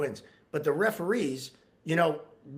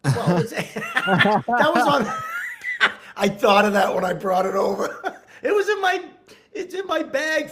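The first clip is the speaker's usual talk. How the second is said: laughing; 10 semitones higher, 8 dB louder, slower than usual. Real, not acted. Laughing at the dirty shirt.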